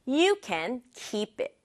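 You can keep it, slow and even; slow classroom model sentence; slow model read. Shown as a demonstration. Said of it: "slow model read".

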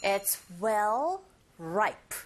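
very slow, each word drawn out; slow model reading. Shown as a demonstration. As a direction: say it slowly for learners to copy. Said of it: "발음이 만만치 않습니다". (아주 천천히 또박또박)

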